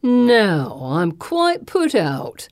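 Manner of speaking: very slow, drawn-out villain voice; menacing displeasure. A narrator's reading. Thug approaching his caught captives, menacing. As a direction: stretch it out with mock grievance.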